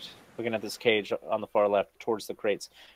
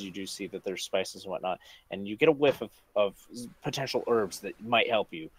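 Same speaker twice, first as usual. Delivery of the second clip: ordinary delivery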